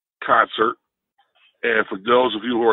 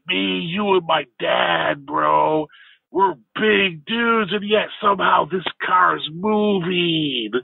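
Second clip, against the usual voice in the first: higher, slow, laid-back California surfer voice; 6 semitones higher, much slower than usual. Retold or imitated. Big stoned son in the back seat greeting the driver, higher California surfer voice. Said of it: "California-ish surfer guy".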